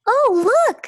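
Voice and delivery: squealed high and loud; a class of kids spotting their teacher; excited kids. Acted. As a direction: cry it out like thrilled children. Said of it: "said the class".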